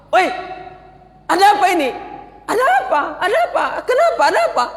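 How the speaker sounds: high, loud, furious demand; priest's angry high cry; angry outburst. Acted. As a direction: snap at them in outrage. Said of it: "Tiba-tiba Buhairah marah".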